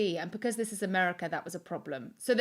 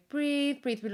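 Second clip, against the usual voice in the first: lackadaisical coaching; 6 semitones higher, slower than usual. Retold or imitated. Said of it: "so lackadaisical".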